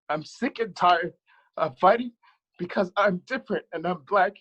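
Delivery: real overwhelmed plea, high, with heavy breaths between phrases; getting emotional. Real, not acted. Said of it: "I'm getting emotional".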